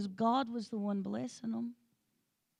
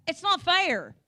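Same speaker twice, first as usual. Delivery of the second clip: put-on higher, louder childish whine; whiny kid; 6 semitones higher, 8 dB louder, slower than usual. Kids complaining to their parents, mock whine. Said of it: "kids looked at you and said".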